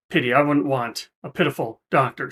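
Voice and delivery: ordinary talk, nothing marked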